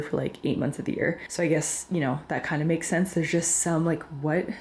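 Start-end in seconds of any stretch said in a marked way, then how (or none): none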